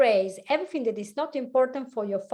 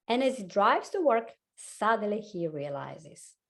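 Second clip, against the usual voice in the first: ordinary delivery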